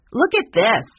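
high, loud boast. A narrator's reading. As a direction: call out to show it off. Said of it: "said Lucasta loudly".

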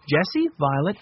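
plain reading voice

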